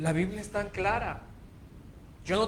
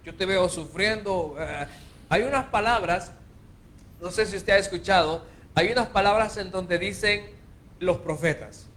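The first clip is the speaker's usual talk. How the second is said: ordinary talk, nothing marked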